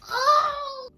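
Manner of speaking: loud, high dramatic gasp; big theatrical intake; shocked gasp. Acted. Reacts to shocking phone call.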